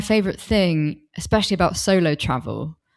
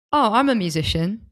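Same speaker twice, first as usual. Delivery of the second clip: a touch of proud self-announcement; mildly confident, self-assured introduction; with conviction; 4 semitones higher than usual. Retold or imitated. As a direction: declare it like it's obviously true. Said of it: "You said it with such conviction".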